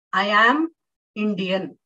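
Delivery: "am" said separately and loud, pause before Indian; full strong form of "am", punched; stress on AM. Shown as a demonstration. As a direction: lean hard on the word "am". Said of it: "I am stressing on the word am".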